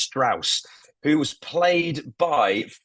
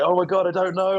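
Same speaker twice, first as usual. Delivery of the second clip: crying suspect; 7 semitones higher than usual. Retold or imitated. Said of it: "This guy's crying".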